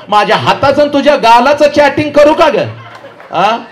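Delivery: high, rising slap warning; playful scolding question; mock threat. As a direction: threaten a slap as a joke, pitch climbing.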